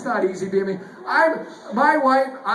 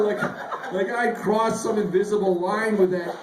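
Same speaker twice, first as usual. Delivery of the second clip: ordinary talk, nothing marked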